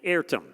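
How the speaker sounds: értem given with Hungarian sounds, first syllable stressed, for the audience to repeat; Hungarian word. Shown as a demonstration. Teaching the audience a Hungarian phrase, saying értem clearly. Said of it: "I'll teach you".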